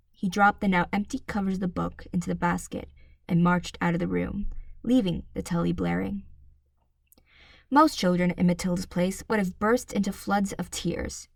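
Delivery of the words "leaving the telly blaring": ordinary delivery, nothing marked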